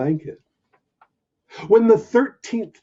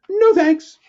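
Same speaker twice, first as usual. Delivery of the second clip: higher, quick little puppy no; nervous puppy; 14 semitones higher, 7 dB louder than usual. Acted. Puppy turning down the lion, high and nervous. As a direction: squeak it out and get away.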